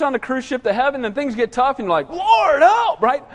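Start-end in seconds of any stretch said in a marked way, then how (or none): 2.1-3.0 high, drawn-out cry for help; mock crying out. Believer calling God when things get tough, hammed-up cry. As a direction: wail up to heaven in pretend panic. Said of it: "you cry out to God".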